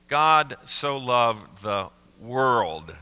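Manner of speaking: slow, spaced-out words of Jesus; measured, weighty delivery; solemn quote. Retold or imitated. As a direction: quote it slowly, letting each word land.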